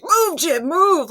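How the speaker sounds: high, loud urgent shout; a viewer urging Jim in a high, loud shout; shouting at the screen. Retold or imitated. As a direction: yell it like a viewer willing him to run. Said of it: "you're just like".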